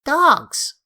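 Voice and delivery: sudden high, loud cry of alarm; shouted. A narrator's reading. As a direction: yell it out as a warning. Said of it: "he leapt up and shouted".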